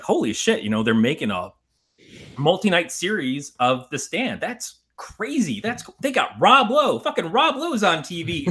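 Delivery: high-pitched, giddy re-enacted amazement; recalled fan excitement. Recalling the thrill of the nineties TV casting, mock-awed excitement. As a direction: voice soars as you act out being thrilled.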